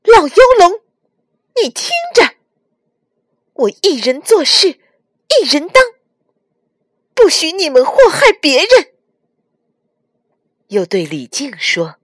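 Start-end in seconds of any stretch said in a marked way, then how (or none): 0.0-8.9 put-on high, loud boy's bellow; great defiant shout. A narrator's reading. Nezha on the wall challenges the dragons, shouting. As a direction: hurl a challenge skyward at full voice. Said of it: "指天大喝".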